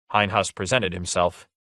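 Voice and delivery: usual speaking voice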